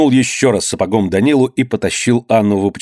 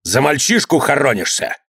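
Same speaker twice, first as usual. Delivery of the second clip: higher-pitched, mildly shouted accusation; angry cry; 5 semitones higher than usual. A narrator's reading. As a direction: accuse her with a touch of outrage. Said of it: "крикнул Иван". (сердитый выкрик)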